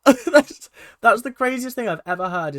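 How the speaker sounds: loud, high, laughing outburst; fast and incredulous, bursting with laughter; amused disbelief. Real, not acted. Reviewer reacting to the dad's crazy advice, laughing in disbelief.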